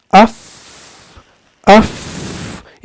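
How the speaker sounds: "अफ" with a strong, long rush of air on f; exaggerated air release. Shown as a demonstration. Teacher pointing out air at the end, heavily breathed "अफ". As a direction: blow the f out at the end of "अफ". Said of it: "air जो यहां पर last पे जा रहा है".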